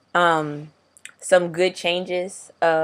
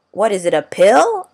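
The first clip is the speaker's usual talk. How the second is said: higher, louder curious question; imitating puzzled coworker; 5 semitones higher, 8 dB louder than usual. Coworker asking how it is taken, imitated in surprise. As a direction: play the baffled coworker, punching it up. Coworker quizzing about the testosterone.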